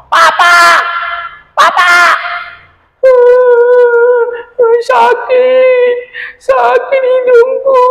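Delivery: very high, loud, slow wailing; sobbing little creature calling for help; crying in pain. Acted. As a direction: cry out for help, nose hurting. Anjani crying to her father.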